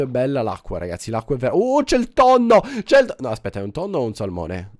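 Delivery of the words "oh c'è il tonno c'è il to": sudden loud, high shout of delight; excited shout. Real, not acted. Gamer spotting a fish in the sea, excited shout. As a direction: blurt it out, thrilled at the find. Spots a tuna in water.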